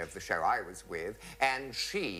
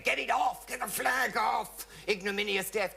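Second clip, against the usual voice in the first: 1 outraged vicar; 12 semitones higher than usual. Retold or imitated. Vicar shouts at the flag.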